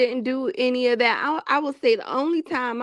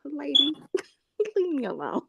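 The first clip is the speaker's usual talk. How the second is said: softer, giggling brush-off; laughing retort; 4 semitones higher, 4 dB quieter than usual. Retold or imitated. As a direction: wave her off through a laugh.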